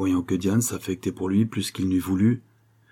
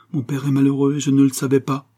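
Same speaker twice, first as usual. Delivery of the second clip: higher, louder distressed lament; sobbing, understated; 5 semitones higher, 5 dB louder than usual. A narrator's reading. Diane learning of her father's unhappiness, distressed lament. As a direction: lament with only a hint of tears. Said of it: "répondit Diane en sanglotant".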